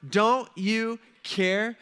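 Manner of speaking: hurt complaint. Acted. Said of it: "You just got Martha ... saying".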